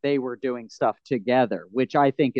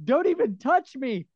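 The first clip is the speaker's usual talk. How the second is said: high-pitched mock recoil; mock-alarmed yelp into a protest; acted startled recoil; 11 semitones higher than usual. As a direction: imitate someone jumping back, voice leaping up. Retold recoil from bloodied man.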